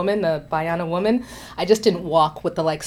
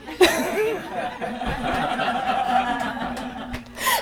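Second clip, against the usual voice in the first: long laugh; 6 semitones higher, 4 dB quieter than usual. Real, not acted. Laughs at the punchline.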